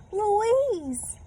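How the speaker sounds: high, loud happy shout; overjoyed cry. A narrator's reading. Sheila Ray finding Louise, high loud joy. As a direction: cry her name with relief. Joyful reunion.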